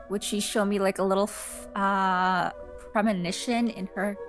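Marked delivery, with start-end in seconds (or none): none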